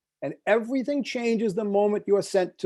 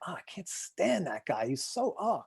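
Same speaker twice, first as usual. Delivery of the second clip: muttered gripe; 9 dB quieter than usual. Retold or imitated. Women griping after the talk.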